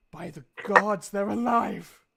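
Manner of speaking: high, loud cry of relief; overjoyed dwarf. Acted. Crestfallen dwarf seeing the kids brought back: loud, high joyful cry. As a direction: burst out with relief and joy.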